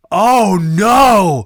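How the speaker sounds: put-on shocked kid. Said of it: "just freezing and being like".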